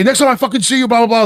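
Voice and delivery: high mocking threat, dismissive. Mocking Craig's threat to the listener, in a high jeering voice.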